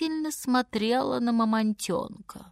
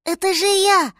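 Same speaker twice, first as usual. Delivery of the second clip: put-on high, loud, pleading baby voice; little mammoth; 10 semitones higher, 6 dB louder than usual. A narrator's reading. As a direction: plead to be recognised as a small animal. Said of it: "сказал он".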